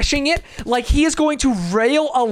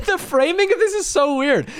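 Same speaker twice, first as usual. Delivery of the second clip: high-pitched, amused complaint; laughing disbelief; 9 semitones higher than usual. Real, not acted. Streamer mocking the video's framing, high laughing disbelief. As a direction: let the laugh push the words up high.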